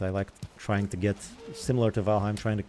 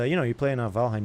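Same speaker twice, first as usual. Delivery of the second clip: usual speaking voice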